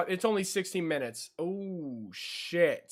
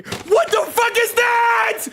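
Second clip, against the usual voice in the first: startled scream; 11 dB louder, slower than usual. Real, not acted. Streamer startled by something scary.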